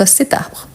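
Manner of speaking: "cet arbre" run together; liaison. Shown as a demonstration. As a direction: link "cet" to "arbre" with a sounded t. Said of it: "quand on fait la liaison, oui".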